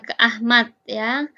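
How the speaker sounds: ordinary talk, nothing marked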